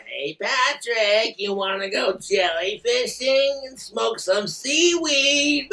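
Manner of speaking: high, excited cartoon sponge with trilling laugh; high, drawn-out goofy cartoon voice; SpongeBob. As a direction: bracket the line with a long, high cartoon cackle. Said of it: "when I start doing SpongeBob's voice".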